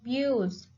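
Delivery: "abuse" with BUSE carrying the stress; stress on second syllable. Shown as a demonstration. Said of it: "The stress is on second syllable".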